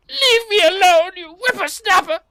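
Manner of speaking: put-on high, loud cracking old-lady screech; cranky kobold granny. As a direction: shriek at the intruder like an angry old granny. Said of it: "the kobold comes back and goes".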